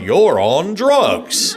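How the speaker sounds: hammed-up ape man growl, slow and loud. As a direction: drag out the words like a goofy ape man, laughing. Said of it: "I'm seeing this ape man going".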